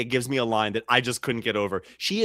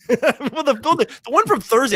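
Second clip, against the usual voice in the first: laughing; 10 semitones higher, 6 dB louder than usual. Real, not acted. Laughing while talking.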